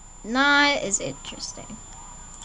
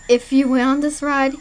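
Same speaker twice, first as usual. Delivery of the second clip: ordinary talk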